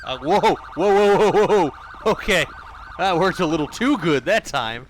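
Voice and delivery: high, loud whoa of surprise; startled exclamation. Real, not acted. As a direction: caught off guard by the physics. Dragged body flies away.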